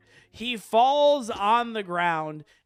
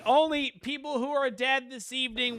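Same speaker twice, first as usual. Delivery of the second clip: ordinary delivery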